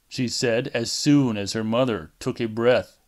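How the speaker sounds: ordinary delivery, nothing marked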